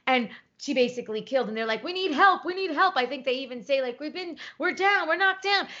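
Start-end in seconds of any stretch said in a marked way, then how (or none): 1.8-3.0 high-pitched imitated plea for help; mock distress call. Retelling astronauts calling for help, mock urgent plea. 4.0-5.7 high-pitched imitated report of trouble; mock distress call. Retelling astronauts reporting they are down, mock urgent plea.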